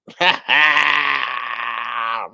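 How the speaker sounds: long, loud open-throated cackle. Real, not acted. Streamer mocking a woman's fake Tesla, loud drawn-out cackle. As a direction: burst out cackling and let it ring out. Cackling over her own jokes.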